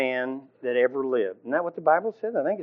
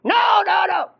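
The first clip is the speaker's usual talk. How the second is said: high, loud mock shouted refusal; hammed-up stubborn yelling; Luther shouting no; 6 dB louder than usual. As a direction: shout down the other man.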